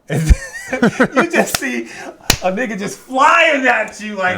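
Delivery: high, drawn-out laughing delivery. Real, not acted. Podcaster telling friends about the courtroom leap, laughing. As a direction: laugh through the telling, pitch way up.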